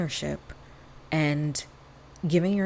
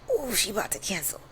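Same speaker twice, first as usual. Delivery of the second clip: inner introvert glee; 3 semitones higher, faster than usual. Retold or imitated. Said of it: "my inner introvert getting happy".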